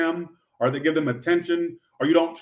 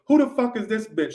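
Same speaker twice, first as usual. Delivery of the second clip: put-on higher, indignant girlfriend quote from Friday; Craig's girlfriend from Friday; 7 semitones higher than usual. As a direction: pitch it up like the jealous girlfriend bursting in. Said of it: "and she goes".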